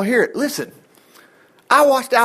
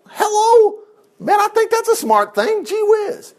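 sarcastic exclamation; 12 semitones higher than usual. Real, not acted. Sarcastic reaction to curse.

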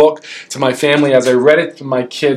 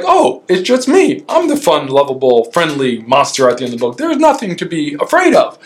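Grover's voice. Retold or imitated. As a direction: reassure like a sweet furry monster. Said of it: "He's like".

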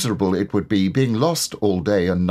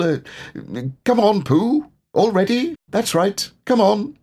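higher and louder, hurried and flustered; flustered Rabbit; 10 semitones higher, 3 dB louder than usual. A narrator's reading. Rabbit hurrying Pooh away from Roo, high and flustered. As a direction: cover the slip, bustling everyone along.

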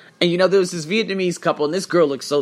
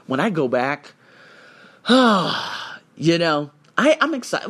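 ordinary talk

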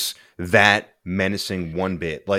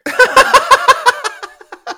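loud, high burst of laughter; cracking up hard at the joke; big real laugh; 11 dB louder than usual. Real, not acted. Laughing at the co-host's murder joke, a loud high burst.

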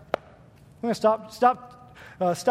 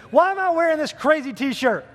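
usual speaking voice

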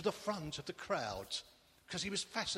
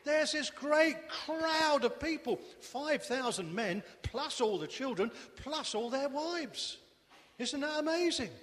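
excited storytelling; 8 semitones higher, 4 dB louder than usual. A narrator's reading. Storyteller builds excitement over crowd.

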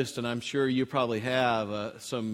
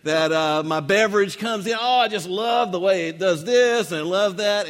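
higher, louder mock raving; gushing beer lover; 5 semitones higher, 5 dB louder than usual. Preacher imitates boyfriend on the grass, gushing about his beverage. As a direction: gush over every little detail. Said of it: "he's going on about".

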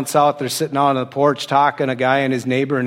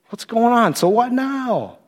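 bewildered dismay; 9 semitones higher, slower than usual. Retold or imitated. Retold dismay, questioning God.

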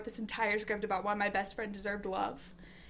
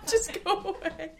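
laughing; 7 semitones higher than usual. Real, not acted. Laughing harder.

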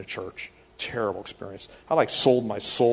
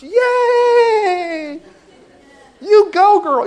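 very high, very loud shriek; screaming church mom; 13 dB louder, much slower than usual. Retold or imitated. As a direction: shriek like a hyped church lady. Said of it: "Mom's like the church".

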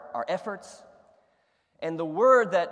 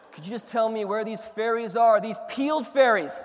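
louder and higher, exasperated; plead, exasperated, for the peeled fairies; frustrated plea; 5 semitones higher, 6 dB louder than usual. Retold or imitated. Frustrated shopper begging the grocer for fairies, exasperated plea. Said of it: "It's like".

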